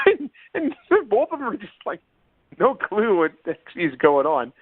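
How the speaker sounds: usual speaking voice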